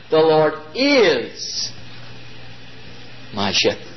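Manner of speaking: "is" stretched slow, "my shepherd" sinks soft; stress on is. Shown as a demonstration. Preacher lingering on "is" for assurance. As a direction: linger on "is", then let it fall away. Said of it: "that's assurance".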